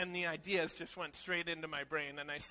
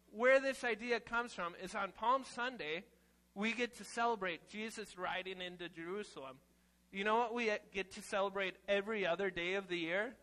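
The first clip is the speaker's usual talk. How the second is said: usual speaking voice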